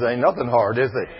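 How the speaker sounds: ordinary talk, nothing marked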